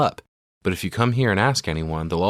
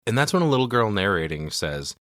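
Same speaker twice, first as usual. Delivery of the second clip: usual speaking voice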